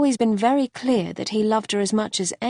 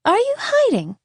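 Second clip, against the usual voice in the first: put-on high, louder child's voice; curious little girl; 13 semitones higher, 3 dB louder than usual. A narrator's reading. Little girl questioning a boy in a tree, curious and high. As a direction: call up like an inquisitive child.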